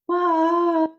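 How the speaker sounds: high, squeaky mock bafflement; baffled what. Retelling being baffled by cryptic Jesus, high baffled. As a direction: play up being totally lost.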